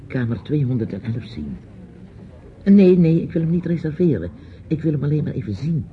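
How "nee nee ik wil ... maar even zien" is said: insistent protest. Acted. Insisting to hotel clerk.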